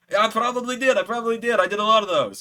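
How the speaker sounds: high, loud, imitated Scottish accent; rough Scottish accent. Wrestler admitting he forgot, high loud imitated Scottish accent. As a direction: shrug it off in Joe's Scottish lilt. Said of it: "I can't do a great Scottish accent".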